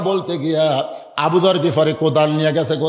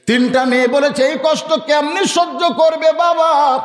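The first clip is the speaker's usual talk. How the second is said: high, chanted sermon storytelling; impassioned high sermon cadence; melodic preaching; 10 semitones higher than usual. Real, not acted. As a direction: intone the sacred story to the congregation.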